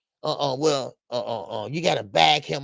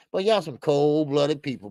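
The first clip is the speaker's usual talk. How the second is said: normal talking voice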